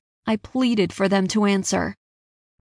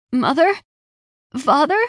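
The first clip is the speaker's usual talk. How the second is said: high, loud rising calls; choked calling out; 6 semitones higher, 5 dB louder than usual. A narrator's reading. Searching a smoke-filled house for parents, choked calls. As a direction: call anxiously into the unknown, voice climbing. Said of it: "I choked".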